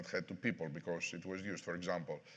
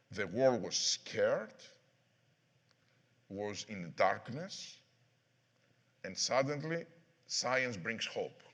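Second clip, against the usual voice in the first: slow solemn; slower than usual. Real, not acted. Solemn closing statement.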